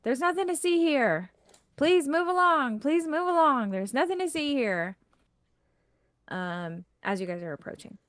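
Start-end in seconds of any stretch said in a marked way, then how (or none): 0.0-5.0 high-pitched guard calling out; town guard. Acted. Guards to a crowd at the gates, calling out. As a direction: call out to move a crowd along. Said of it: "you can hear some guards".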